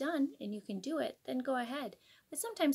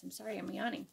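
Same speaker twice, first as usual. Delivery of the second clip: usual speaking voice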